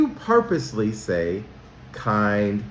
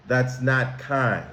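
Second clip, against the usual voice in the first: usual speaking voice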